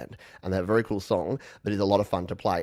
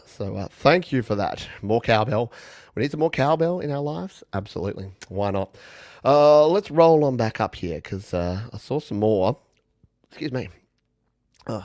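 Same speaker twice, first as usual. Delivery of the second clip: ordinary delivery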